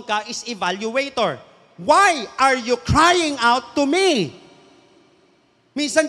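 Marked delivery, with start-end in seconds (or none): 1.8-4.4 slow, loud, hard-stressed challenge; forceful rebuke. Acted. Preacher voices God confronting Moses, loud slow rebuke. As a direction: demand an answer, leaning on every word.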